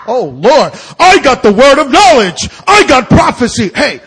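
high, loud hammed-up boasting; exaggerated revival-style exclaiming; mock over-excitement. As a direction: shout it like an instant prophet. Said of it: "You just don't like".

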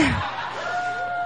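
high, soft laugh; a quick embarrassed laugh; real self-conscious giggle. Real, not acted. Laughs at own slip.

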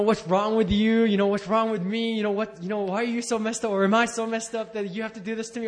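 high, loud, hammed-up plea of complaint; mock complaining to God. Retelling prayers to a church audience, high loud mock complaint. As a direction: whine it up at God, half-mocking. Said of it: "arguing and kind of fighting and complaining".

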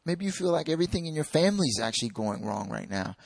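ordinary talk

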